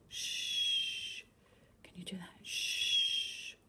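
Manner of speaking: soft whispered shush. Shown as a demonstration. Storyteller shushes child, whispered. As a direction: hush the listener gently. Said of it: "why we whisper quietly".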